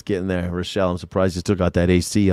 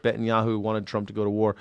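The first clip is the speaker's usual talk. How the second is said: usual speaking voice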